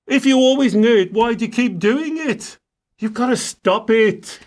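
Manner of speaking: a touch of frustrated scolding; exasperated. Real, not acted. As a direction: push back in disbelief. Venting at client's excuse.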